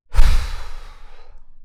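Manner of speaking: long exhaled sigh; heavy sigh. Real, not acted. Pausing on a memory that bummed him out, sighing. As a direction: let out a long wordless breath. Pause before sad memory.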